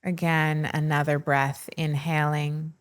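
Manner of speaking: slow, measured meditation voice with long pauses; unhurried, soothing breathwork narration; guided meditation. Acted. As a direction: guide listeners gently into stillness, leaving space between phrases.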